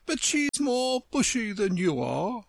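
lofty and superior; haughty flower. Acted. Tiger Lily voiced.

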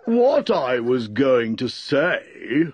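ponderous, affronted character voice; offended. A narrator's reading. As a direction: draw it out with wounded dignity. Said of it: "said the dodo in an offended tone".

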